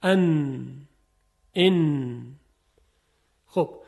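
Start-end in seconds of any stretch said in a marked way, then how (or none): 0.0-2.3 «ان» with tongue on the upper gums and a nasal hum; nun sound demo. Shown as a demonstration. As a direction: hum «ان» through the nose. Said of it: "برای تلفظ نون سر زبان". (تلفظ «ان» با غنه)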